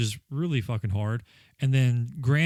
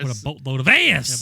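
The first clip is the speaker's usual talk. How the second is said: louder, disgusted mock verdict; mock angry reviewer; 4 dB louder than usual. Playing the angry game reviewer for his cohost. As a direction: spit it out like a ranting critic. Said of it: "embody the angry part".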